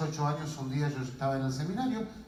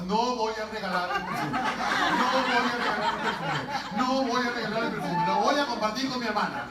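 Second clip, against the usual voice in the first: higher, firm line to repeat; coaxing dictation; 5 semitones higher, 4 dB louder than usual. Acted. Sibling making sister repeat a promise, coaxing dictation. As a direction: spell it out for someone gone crazy. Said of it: "Repetí conmigo".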